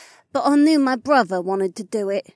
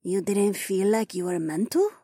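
mild surprise; 9 semitones higher than usual. A narrator's reading. Naiad questions the elf woman, mildly surprised. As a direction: ask with a degree of surprise. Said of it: "with a degree of surprise in her voice".

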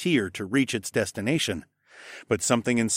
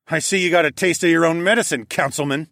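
snorting; 6 semitones higher, 6 dB louder, faster than usual. A narrator's reading. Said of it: "I snorted".

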